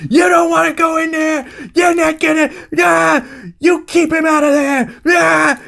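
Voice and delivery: high, strained mock shouting; John Wayne horrified. Uncle keeping others out of the house, horrified shouting. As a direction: holler in hammed-up horror, breaking into cries. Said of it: "John Wayne's horrified reaction to the violence".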